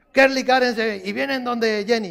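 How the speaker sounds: ordinary talk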